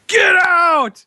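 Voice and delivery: high, long-held comic holler; imitated yelling. Imitating big Minnesota guy leaning over mice, high holler. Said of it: "starts yelling at him".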